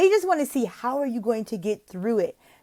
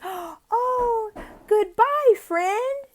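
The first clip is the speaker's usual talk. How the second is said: very high, squeaky farewell; excited goodbye; 3 dB louder, much slower than usual. Shown as a demonstration. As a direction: squeal goodbye to a little friend. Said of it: "you can say".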